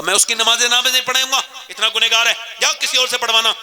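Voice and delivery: high, loud and fast rebuke; scolding refusal. Retold or imitated. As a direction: drive her off with a scolding. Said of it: "آپ نے اس کو ڈانٹ دیا". (غصے سے ڈانٹنا)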